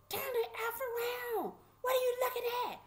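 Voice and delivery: high, menacing holler; imitated screaming guard. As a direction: scream orders like a mean sheriff. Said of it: "screaming and hollering at us".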